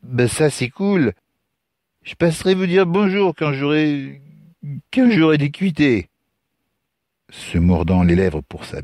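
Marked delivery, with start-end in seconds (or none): 0.0-6.1 put-on high, stumbling drunk young man; drunken stammer. Drunk stranger promises Lucas a visit, stammering. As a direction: drag and repeat words tipsily. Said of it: "balbutia l'autre".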